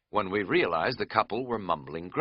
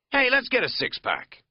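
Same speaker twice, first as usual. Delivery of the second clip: loud, high party whoop; 5 dB louder than usual. Acted. Katz suggesting beer in the store, loud party voice. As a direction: pitch it like a keg party. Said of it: "he said suddenly in a party voice".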